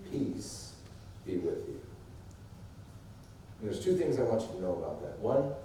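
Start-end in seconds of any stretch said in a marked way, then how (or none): 0.1-1.8 hushed, very low word of peace; calm blessing. Acted. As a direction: drop to a soft, soothing greeting. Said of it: "and says what?".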